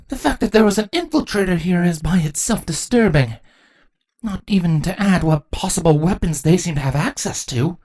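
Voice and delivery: high, troubled queen's voice; high-pitched regal worry. Acted. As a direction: voice a queen's unease at the threat. Queen disturbed by the news.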